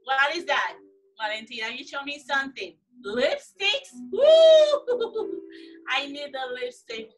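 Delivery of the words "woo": high, loud burst of laughter; delighted laugh. Real, not acted. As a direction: whoop then laugh out loud. Delighted at a child's lipstick.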